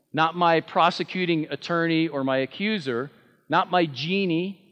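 ordinary talk

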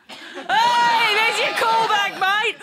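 high, loud cheer to someone; calling out. Real, not acted. Comic hailing Tom in the crowd, loud high call. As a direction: hail a mate across the room. Calls out to audience member.

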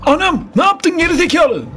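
shocked angry scream. Real, not acted. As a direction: scream at the screen in disbelief. Game death, yelling at screen. (çığlık atarak öfkeli bağırış)

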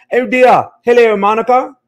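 loud, high-pitched mock British greeting; British accent. Streamer hamming up British accent for London viewer Monica. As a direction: put on a big British accent. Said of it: "that's enough for my British accent".

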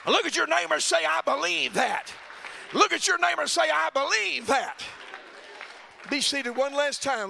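loud, high, shouting preacher climax; roaring revival preaching; full-force preaching. Acted. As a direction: preach it hard to the whole church.

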